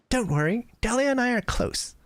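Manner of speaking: put-on high, light girlish voice. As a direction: pitch up into a young girl's voice. Same girl voice again.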